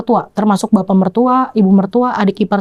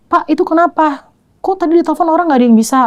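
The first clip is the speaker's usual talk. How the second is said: higher-pitched, urgent questioning; retold panic; 7 semitones higher, faster than usual. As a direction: demand an explanation, mildly panicked and high. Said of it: "Saya udah panik". (menirukan pertanyaan panik bernada tinggi)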